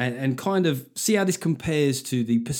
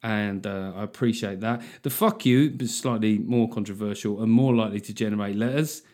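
ordinary talk, nothing marked

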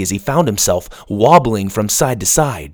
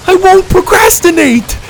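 higher, louder panicked shout; 8 dB louder than usual. A narrator's reading. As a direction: shout the promise. Louder take after demand.

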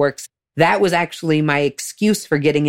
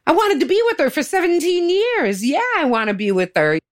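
high-pitched insistent comeback; indignant retort; 12 semitones higher than usual. Retold or imitated. Woman retorting about being with her girlfriend, indignant. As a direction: protest it high, then hurry the last line.

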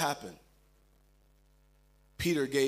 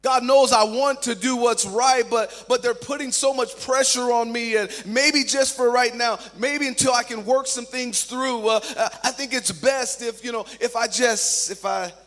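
high, raised-voice self-justification; acted flustered insistence, breaking into false starts; pleading excuses; 6 semitones higher, 4 dB louder than usual. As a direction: plead your case, faltering toward the end. Voicing Peter pleading under pressure.

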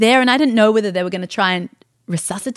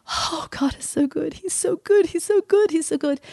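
high, soft, tearful repetition; imitated weeping; 7 semitones higher, 3 dB quieter than usual. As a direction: repeat it as if overcome by tears. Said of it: "as he was weeping".

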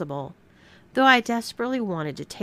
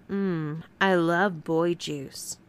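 mock sultry demo; much slower than usual. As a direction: dictate it in a mocking seductive voice. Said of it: "suck it off your finger and say".

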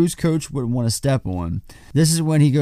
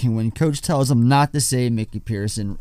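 ordinary delivery

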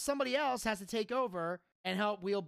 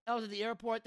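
ordinary talk, nothing marked

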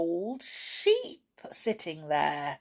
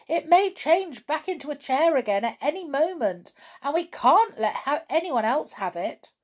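higher, pressing explanation; raised, anxious urging; urgent; 7 semitones higher, 4 dB louder than usual. A narrator's reading. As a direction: press the point home urgently. Urging friends to flee.

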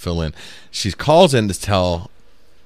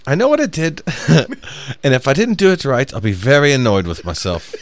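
chill British lady; 5 semitones higher than usual. Retold or imitated. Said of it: "she says".

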